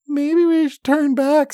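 high, slow, timid halfling voice; nervous halfling. A narrator's reading. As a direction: suggest retreat in a small high voice. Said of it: "says Loris".

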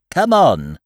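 loud call, moderately high; Owl, calling out. Acted. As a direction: call it out loudly down the line. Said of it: "called Owl".

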